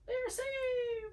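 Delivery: high, slow, drawn-out relief; mock relieved character voice. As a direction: squeal it out in relief.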